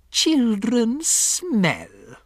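high, very drawn-out cry; slow screech of revulsion; disgusted witch scream. A narrator's reading. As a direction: stretch both words with loathing. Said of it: "she screamed".

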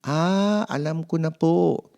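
put-on high, bright little boy realizing; a boy's high voice catching on; child voice. Mark tells his parent he understands now, high boy's voice.

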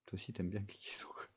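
a quick whispered aside. Real, not acted. Teasing someone nearby about clicking, whispered aside. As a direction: whisper it so the stream barely hears.

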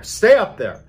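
loud whispered warning turned shout; urgent shout. Retold or imitated. Husband warning family upstairs, loud urgent shout. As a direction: order them upstairs, loud.

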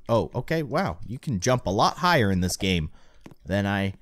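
usual speaking voice

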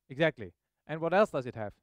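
normal talking voice

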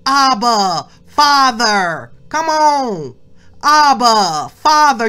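loud, drawn-out cry to the Father; calling out Abba Father. Acted. Preacher crying out to God before the congregation, loud. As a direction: call out to God with full voice.

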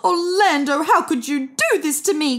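a betrayed owner's plea; Louis crying out at his fat cat. A narrator's reading. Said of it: "cried Louis".

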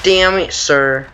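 usual speaking voice

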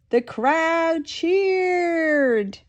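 high, drawn-out cheer like a roaring crowd. A narrator's reading. Nanny reading to kids, voicing the crowd's high cheer. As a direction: stretch it into a big excited cheer.

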